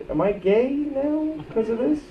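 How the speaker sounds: high, imitated boyish self-questioning; wondering to himself. Boy remembering what he thought with his friend, wondering. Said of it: "I remember thinking".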